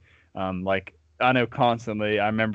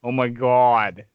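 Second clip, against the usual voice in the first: slow, louder mock Yankee accent; exaggerated Northern accent; 5 dB louder, slower than usual. Mocking you loud Yankees, exaggerated Northern accent. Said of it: "it's so exaggerated with".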